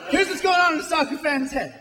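usual speaking voice

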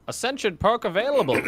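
booming wartime newsreel announcer; propaganda announcer voice. Pretend. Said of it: "World War Two propaganda announcer voice".

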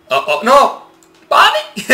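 high squeal cracking into a laugh; panicked squeal. Real, not acted. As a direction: shriek and laugh at the raptor. Panicking at the raptors.

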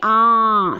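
drawn-out nasal ã, mouth open; nasal aa sound. Shown as a demonstration. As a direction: sound आ through nose and mouth together. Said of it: "फिर से एक बार उच्चारण कीजिए".

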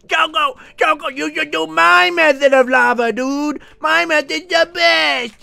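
high-pitched whiny caricature of a fan; mock the chat in a squeaky put-on voice. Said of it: "Everyone was saying".

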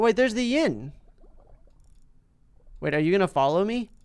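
ordinary delivery

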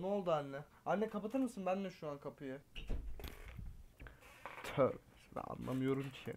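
distant side talk to his mother; off-mic aside. Real, not acted. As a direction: talk to mom across the room.

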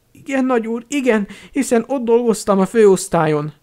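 magas, alázatos szolgahang; alázatos szolga. A narrator's reading. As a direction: felelj magasan, buzgón. Alázatos szolga felel urának. (meghunyászkodó szolga magas hangja)

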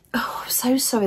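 soft whispered apology; whispering. Real, not acted. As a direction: breathe the sorry out without voice.

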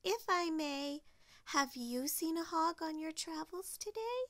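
a hint of a courteous little character; polite Lil. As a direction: ask politely in a lifted voice. Voices Lil asking politely.

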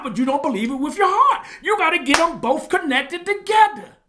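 high, driving sermon voice; impassioned preaching. Real, not acted. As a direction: preach it loud and high, pressing every clause home.

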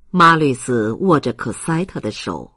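usual speaking voice

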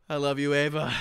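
ordinary delivery

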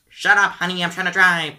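a mom losing patience at the wheel; snapping mom. Pretend.

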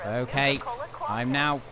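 ordinary talk, nothing marked

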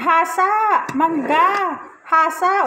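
loud high alternating street calls; hawkers' cries. Retold or imitated. Joke punchline, she alternates the sharpener's and mango seller's loud calls. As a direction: shout like two vendors calling over each other. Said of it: "yung maghahasa naman sisigaw ng".

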